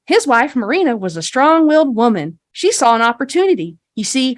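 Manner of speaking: folksy, rehearsed storytelling; tour guide spiel. Acted. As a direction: confide the founding tale to tourists with showy emphasis. Game master voices guide's rehearsed spiel.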